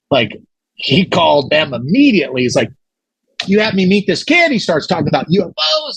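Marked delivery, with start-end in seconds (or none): 3.5-6.0 higher-pitched mock indignation; retold indignant complaint. Angry interviewee complaining to the interviewer's people, indignant. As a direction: imitate an outraged complaint, pitched up. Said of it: "they were pissed".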